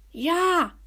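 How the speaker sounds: high, fairly loud yell. A narrator's reading. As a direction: cry it out as a desperate yes. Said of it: "brüllte Lukas".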